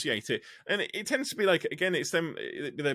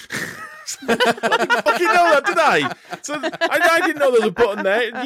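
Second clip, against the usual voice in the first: higher and louder, half laughing in disbelief; laughing exasperation; 11 dB louder than usual. Real, not acted. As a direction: laugh through the outrage at the misunderstanding.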